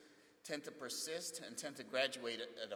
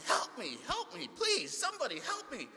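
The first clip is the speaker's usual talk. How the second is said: high, loud, desperate cries for help; a trapped man shrieking for rescue; panicked yelling for help; 13 semitones higher, 7 dB louder than usual. Retold or imitated. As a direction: yell for help as if terrified.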